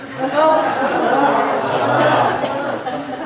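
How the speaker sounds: a high, loud burst of laughter at his own punchline; big laugh. Real, not acted. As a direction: laugh out loud, delighted with the joke. Preacher laughs at own joke.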